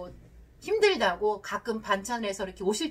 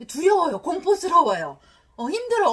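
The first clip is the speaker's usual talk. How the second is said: loud, raised voice of an acted fearful spouse; voicing dread; 6 semitones higher, 9 dB louder than usual. Spouse dreading parents' surprise visits, acted loud alarm. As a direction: act out being overwhelmed, pitched up and loud.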